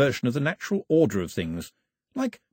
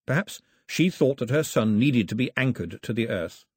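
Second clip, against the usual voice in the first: usual speaking voice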